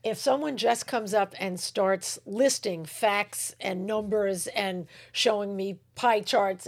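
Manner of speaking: usual speaking voice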